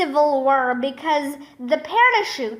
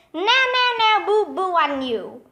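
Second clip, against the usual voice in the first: quick, higher sing-song teasing; playground taunt; 4 semitones higher, much faster than usual. A narrator's reading. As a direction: chant it fast like a teasing kid. Kid taunting a captured vice principal.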